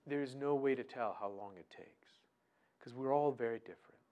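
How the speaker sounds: usual speaking voice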